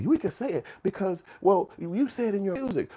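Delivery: mock someone justifying themselves; imitated defensive excuse. Mimicking outsiders defending their use of a slur, high-pitched. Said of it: "They get to say".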